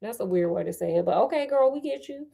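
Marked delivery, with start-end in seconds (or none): none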